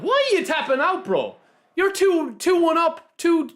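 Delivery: high, disbelieving protest; can't believe they quit; incredulous. Real, not acted. Disbelief at opponent quitting.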